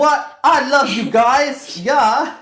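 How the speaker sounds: loud, slow, high mock-British; bad British accent. Mocking her chat with the band, loud bad British accent. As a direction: ham up a fake British fan. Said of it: "Your accents suck".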